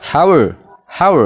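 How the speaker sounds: HOW run into "are" as "hawer"; linked pronunciation. Shown as a demonstration. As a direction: say How as one blended "hawer". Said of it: "kan akkamitti pronounce goona". (jechoota walitti qabsiisuun "hawer")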